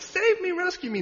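high-pitched mock plea for rescue; pleading girl. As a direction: imitate a girl crying out to be rescued. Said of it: "The girl that's like".

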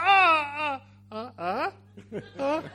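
loud high sob breaking into short gasps; hammed-up sobbing, tapering off; mock crying. Man cries as his brother hugs him, mock crying. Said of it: "and he's crying".

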